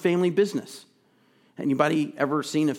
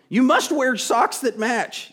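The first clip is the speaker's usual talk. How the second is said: slow, higher, louder motherly decree; stern mom; 9 semitones higher, 5 dB louder, slower than usual. Retold or imitated. Preacher voicing his mom on matching socks, slow and stern. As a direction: spell out the rule like a strict mom. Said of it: "It's just like my mom saying".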